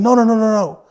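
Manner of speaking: put-on high, loud, pleading "no" of a small child; retold child pleading. Child refusing to hand back the money, high pleading. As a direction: beg like a little kid about to lose the money. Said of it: "I was like".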